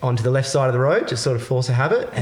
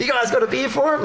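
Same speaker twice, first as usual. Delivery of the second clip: high mock voice; 12 semitones higher than usual. Voiced line after the joke.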